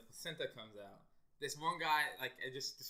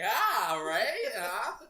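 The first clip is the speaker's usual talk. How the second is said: mock proud doctor; 9 semitones higher, 14 dB louder, much slower than usual. Mimics doctor holding up placenta.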